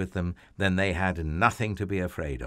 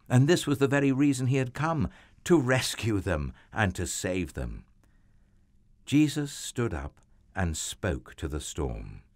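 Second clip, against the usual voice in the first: ordinary delivery, nothing marked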